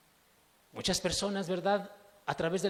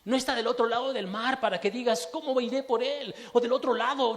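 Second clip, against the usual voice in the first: louder, raised declaration; Moses' exhortation; 6 semitones higher, 5 dB louder than usual. Retold or imitated. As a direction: proclaim it like Moses. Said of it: "Moisés se atrevió a decir".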